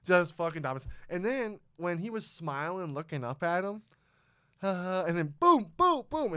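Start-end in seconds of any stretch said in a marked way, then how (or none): 4.6-6.3 punch sound effects. Retold or imitated. Acting out the punches.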